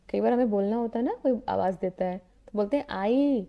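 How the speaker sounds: normal talking voice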